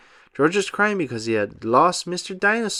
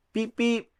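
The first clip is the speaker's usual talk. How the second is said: high light beep beep; car horn beeps; 8 semitones higher, 4 dB quieter than usual. A narrator's reading. Narrator voices the family car, high beeps.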